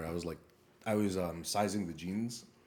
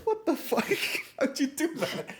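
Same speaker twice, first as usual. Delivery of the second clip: high, loud laughing outburst; laughing disbelief; 6 dB louder, slower than usual. Retold or imitated. To the robbers, laughing in disbelief. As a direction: blurt it out mid-laugh.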